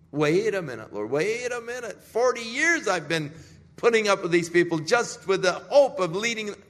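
loud, high pleading outburst; desperate protest. Acted. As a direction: plead back against the verdict. Moses protests God's verdict.

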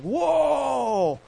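long, high drawn-out whoa; acted startled whoa. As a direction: cry out as if the boat lurches. Acting fisherman as net fills.

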